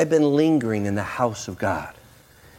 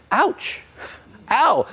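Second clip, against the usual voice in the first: mock ow; 13 semitones higher than usual.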